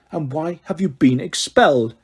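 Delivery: usual speaking voice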